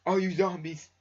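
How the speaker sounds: hammed-up zombie boss yell; mock boss shout. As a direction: bellow at the zombies.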